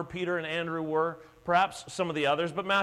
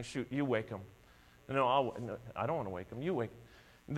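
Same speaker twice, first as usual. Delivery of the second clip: low, much softer nervous back-and-forth; timid disciples pushing the job on each other; sheepish; 7 semitones lower, 8 dB quieter than usual. Retold or imitated. Disciples sheepishly arguing who wakes Jesus, low and soft. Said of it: "sheepishly saying".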